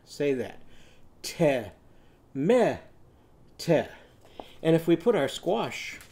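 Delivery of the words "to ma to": "Te, me, te" as teh, meh, teh; tomato with eh. Shown as a demonstration. Teacher changes tomato's vowels, eh in each syllable. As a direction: separate syllables of "Te, me, te", each with short eh. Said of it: "if we use eh in each syllable".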